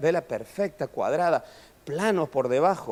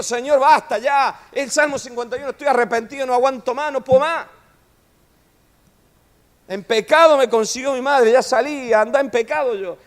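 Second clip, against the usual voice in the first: David pleading; 8 semitones higher, 6 dB louder than usual. Acted. David's desperate confession retold.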